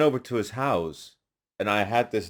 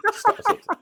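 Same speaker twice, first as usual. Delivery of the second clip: much higher, squeaky mock voice cutting him off; mocking producer; 5 dB louder than usual. Producer halting the rapper's take, squeaky mock stop. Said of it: "he's like".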